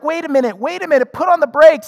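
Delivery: loud, high wait a minute; alarmed halt. Retold or imitated. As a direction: throw up the brakes urgently. Hurt spouse halting the demand.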